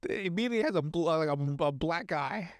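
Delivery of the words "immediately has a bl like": normal talking voice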